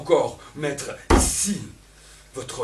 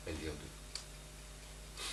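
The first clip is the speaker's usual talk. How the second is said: slow unvoiced whisper; 10 semitones lower, 18 dB quieter, much slower than usual. Reading the stage cue under her breath, in a slow whisper. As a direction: breathe the name out slowly.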